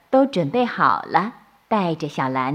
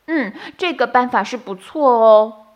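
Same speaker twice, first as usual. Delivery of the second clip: approving bunnies; 4 semitones higher, 4 dB louder than usual. A narrator's reading.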